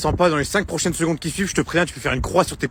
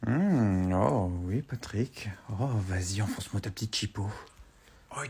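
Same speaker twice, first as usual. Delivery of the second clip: hushed moaning; 7 semitones lower, 8 dB quieter than usual. Acted. Scène intime cachée sous la couette.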